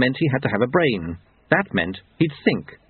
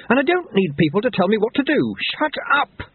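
high agitated outburst, peaking at the end; shouted; 11 semitones higher than usual. A narrator's reading. Jeremy pushes the doctor away, shouting high. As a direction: shout them down, voice climbing sharply. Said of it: "Jeremy shouted".

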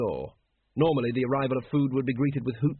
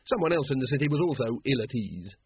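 ordinary delivery, nothing marked

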